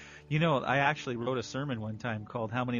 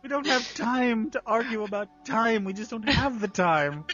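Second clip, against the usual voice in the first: slow, slurry tipsy voice, higher; a lightly played drunk; drunk Deanna; 8 semitones higher, slower than usual. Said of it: "like a drunk Deanna".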